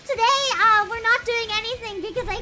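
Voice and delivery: high pitched voice changer; bright cartoonish voice filter. Real, not acted. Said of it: "I'm using a voice changer".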